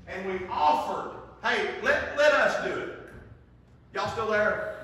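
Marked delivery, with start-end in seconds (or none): 1.4-3.0 loud, high insistent offer; raised-voice plea to hand him over; urgent plea. Retold or imitated. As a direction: push hard to take over. Staff offering to take over.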